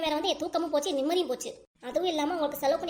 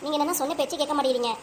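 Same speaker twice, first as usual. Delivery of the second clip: usual speaking voice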